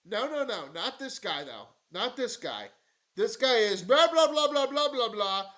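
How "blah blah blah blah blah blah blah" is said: mocking blah blah. Mocking empty speech.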